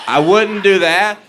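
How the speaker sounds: usual speaking voice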